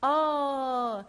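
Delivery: ordinary delivery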